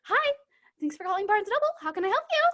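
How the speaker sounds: high, bright phone-greeting voice; chirpy store clerk answering the phone; customer service voice. Shown as a demonstration. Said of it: "customer service voice".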